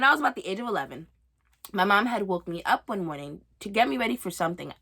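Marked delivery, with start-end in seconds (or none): none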